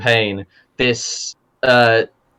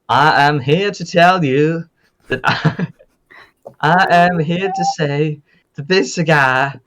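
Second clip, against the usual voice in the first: high, slow Southern drawl of a cowboy; high-pitched hammed-up Southern cowboy voice; southern accent; 5 semitones higher, slower than usual. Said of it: "My southern accent has changed a little bit".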